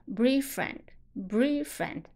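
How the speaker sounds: "brave friend" with the stop before "friend" left unreleased; dropped plosive. Shown as a demonstration. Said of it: "就失去泡泡不读了". (“brave friend”中的爆破音不读出来)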